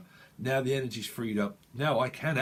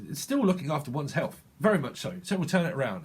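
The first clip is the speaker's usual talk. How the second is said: ordinary talk, nothing marked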